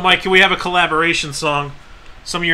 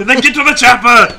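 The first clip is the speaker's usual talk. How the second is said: loud Austrian action voice; Arnold impression; 7 semitones higher, 7 dB louder than usual. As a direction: bark it like Predator. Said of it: "the Arnold Mister Freeze".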